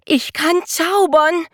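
high, loud and slow, overjoyed; jubilant. A narrator's reading. Jonathan discovers he can do magic, cheering high and loud. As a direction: cheer it out like a mouse who just found magic. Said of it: "jubelte Jonathan".

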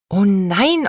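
"nein" pushed up eagerly; girl pleads oh nein with a rising voice; eager protest. Acted. Child protests she's not too big.

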